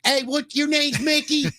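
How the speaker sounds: high, hammed-up Baltimore woman; Baltimore accent. Host as Baltimore woman meeting Mickey, high Baltimore accent. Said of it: "the Baltimore accent".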